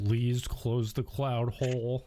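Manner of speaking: slow, low, drawn-out plea; grudging please. Pretend. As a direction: say please slowly and reluctantly, pitched low. Grudging plea after being told "please.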